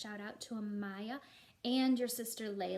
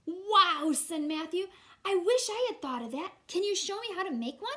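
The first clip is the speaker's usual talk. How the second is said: put-on much higher, louder awed little boy; amazed boy; 8 semitones higher, 11 dB louder than usual. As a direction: gasp it out, wide-eyed kid. Matthew answers in story.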